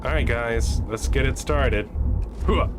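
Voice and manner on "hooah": short barked battle cry; loud gung-ho soldier. Pretend. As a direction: hype the squad like a soldier. Gung-ho cheer before launching in.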